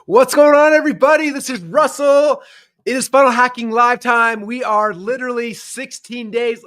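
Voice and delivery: high, loud, punchy greeting; hyped show opening. Real, not acted. Host greets viewers of the live show, loud and hyped. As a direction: kick off the show at full volume.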